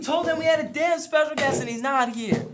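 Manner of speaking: laughing shout. Real, not acted. Host shouting about absent guest.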